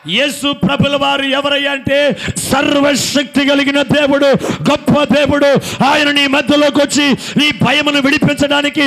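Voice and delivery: loud, high preaching to the congregation; full-force preaching. Acted. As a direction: preach it out, rousing the crowd.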